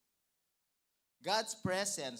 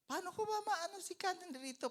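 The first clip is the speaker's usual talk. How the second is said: doubting believer. Retold or imitated. Voicing a doubting believer's question.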